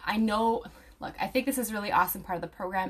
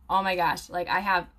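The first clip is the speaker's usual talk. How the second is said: ordinary talk, nothing marked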